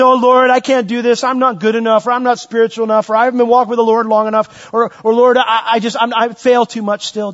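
a fretful believer begging off God's call; whiny excuse-making prayer. Retold or imitated. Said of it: "I say".

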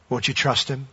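ordinary talk

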